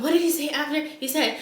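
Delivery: normal talking voice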